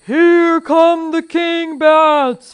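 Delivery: loud, very drawn-out high wail; terrified scream. A narrator's reading. Fezzik paralyzed on the dark steps, long terrified scream. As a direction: bellow it slowly in terror. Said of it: "Fezzik screamed".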